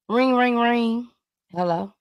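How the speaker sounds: sing-song phone ring, then a plain hello; phone call reenactment. Acted. Host replaying her friend's call for Jason, lightly acted. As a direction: act out a ringing phone being picked up. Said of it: "play back the phone conversation".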